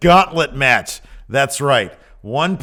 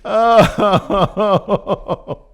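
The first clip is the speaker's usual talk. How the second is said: laughing; 9 semitones higher, 7 dB louder than usual. Real, not acted. Laughing after the bit.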